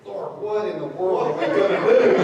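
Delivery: half-laughing mock panic; Moses panicking. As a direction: Moses frets aloud to God, laughing. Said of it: "he's like".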